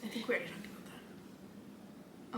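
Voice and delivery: whispered aside to her co-host. Real, not acted. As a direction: drop to a hushed aside.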